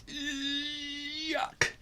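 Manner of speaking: high squeaky yuck; grossed-out yuck; disgusted yuck. A narrator's reading. Disgust at landing in pond.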